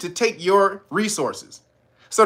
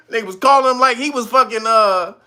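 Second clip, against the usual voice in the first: normal talking voice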